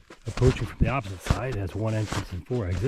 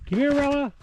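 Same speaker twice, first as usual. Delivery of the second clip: calling the dog. Real, not acted. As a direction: shout her name out to the dog.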